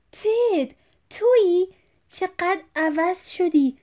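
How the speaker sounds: high, loud, thrilled outburst; excited. Acted. Father excitedly interrupts, recognising his son Ted. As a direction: burst in with delighted recognition.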